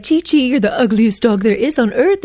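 lightly sugary voice for an insult; a hint of sing-song sweetness to the dog; sweet tone, mean words. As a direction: coo it like praise. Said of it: "mean things in a nice tone".

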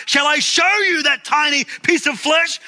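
imitated shout, pitched very high; Zacharias Werner shouting. Preacher acting a priest leaning from the pulpit, rising shout. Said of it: "his voice rose to a hoarse shout".

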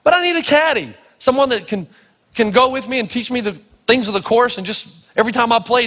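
high-pitched, pleading protest; insistent pleading. Retold or imitated. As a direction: press the request, acting out the plea. Retells pleading with caddy master.

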